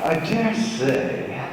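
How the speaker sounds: slow, prim mock British accent, lightly put on. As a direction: play a proper English gent in a British accent. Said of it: "I used to practice that".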